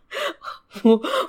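high rising giggle; a bright giggling laugh. Real, not acted. Telling about nice laugh.

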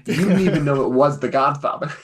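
ordinary delivery

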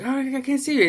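high-pitched mimicked line; mock straining to see. Voices a character, high and loud.

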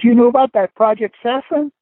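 put-on high kid's voice asking; mock little boy voice; shoeshine boy. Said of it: "he says".

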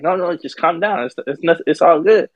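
placating. Retold or imitated. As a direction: retell trying to talk someone down. Group soothing an angry man.

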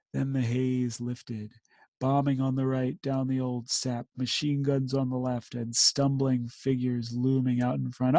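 solemn war poem recital; poem reading. Acted. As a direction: read the verse with grave weight.

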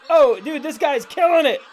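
high, loud amazed praise; excited praise. Real, not acted. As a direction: rave about the jump. Cheering a Shrek video.

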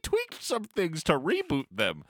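high, slow, grandiose rich guy; Bruce Wayne voice. Retold or imitated. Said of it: "Bruce Wayne is like".

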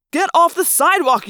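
loud, high-pitched order; alarmed shout. A narrator's reading. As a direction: shout it urgently at a reckless driver. Passenger shouts at reckless driver.

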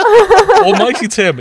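slow, high and laughing. Real, not acted. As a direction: laugh out the praise. Laughing praise.

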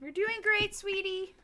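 put-on high, sing-song praise for a child; doting mom voice. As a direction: coo it like a proud parent. Mock parent cheering on the pour.

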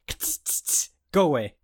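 imitated spray-bottle hiss, then a brisk go away.

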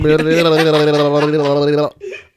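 long loud laugh. Real, not acted. Host keeps laughing.

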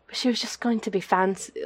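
tentative stab at high-society British; fancy British accent. Shown as a demonstration. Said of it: "fancy kinda British voice".

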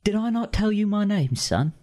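gruff Cockney bird, teasing; Cockney accent. Acted. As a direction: crow speaking in a Cockney accent. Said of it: "my Cockney accent".

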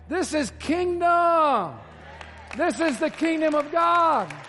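high, loud, drawn-out declaration; slow proclaiming shout. Real, not acted. Pastor proclaiming to his church, a slow high shout. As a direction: stretch every word and proclaim it. Climax of sermon point.

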